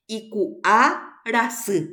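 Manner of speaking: teacher's deliberate demo of "Iku'arasy"; roots shown separately. Shown as a demonstration. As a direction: stretch "Iku'arasy" into its two roots. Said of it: "Ahí tiene dos raíces".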